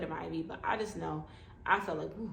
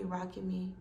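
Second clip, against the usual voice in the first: ordinary talk, nothing marked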